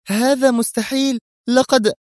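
very high astonished woman's voice; stunned disbelief. A narrator's reading. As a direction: exclaim in shock, cut off mid-sentence. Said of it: "هتفت منى في ذهول". (ذهول بصوت نسائي عالي الطبقة)

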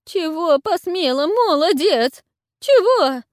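trembling voice. A narrator's reading. Old witch asks the raging warlord, trembling and shrill. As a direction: quaver it out in terror. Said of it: "Дрожащим голосом спросила старуха".

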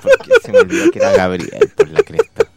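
high giggly words through laughter; laughing. Real, not acted. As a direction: talk while cracking up. Laughing at Gabriel's question.

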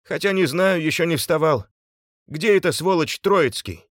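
high, loud, aggrieved groan. A narrator's reading. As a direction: groan it out to those around you. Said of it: "простонал Субботин".

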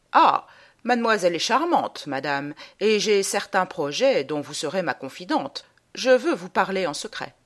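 ordinary talk, nothing marked

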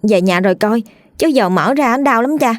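put-on a little boy insisting it's fine; boy brushing it off. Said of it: "Thằng Đen xua tay".

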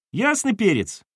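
put-on high boy's cheeky exclamation; boyish exclamation. A narrator's reading. Son brushing off his mother, cheeky high voice. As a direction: toss off the slang like a kid.

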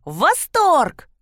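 put-on high childlike exclamation of delight; excited little one. A narrator's reading. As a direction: cheer it out while jumping for joy. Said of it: "— запрыгала киса".

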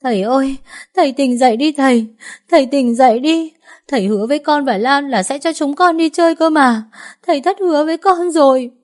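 put-on a little girl begging her dead father to wake up; sobbing child. A narrator's reading. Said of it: "Cô òa khóc nức nở".

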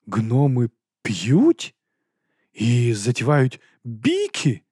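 very slow, shocked questions; aghast disbelief. A narrator's reading. Pale Carrot asks Nobby about brawling dwarfs, aghast. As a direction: draw out each question, swooping up in horror. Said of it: "Морква пополотнів".

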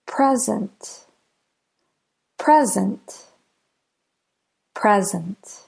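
"Present" with PRE longer and stronger; "Present" as a noun, PRE-zent; stress on first syllable. Shown as a demonstration. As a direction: lean on the first syllable of "Present", let the second drop. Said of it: "Let's listen to some examples of two-syllable nouns".

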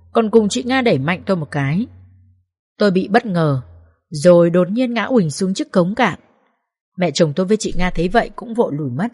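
plain reading voice

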